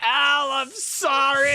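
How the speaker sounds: high, drawn-out mock wail; hammed-up high-pitched cry; mock scream. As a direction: imitate an anguished cry, stretched out. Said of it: "comes and screams".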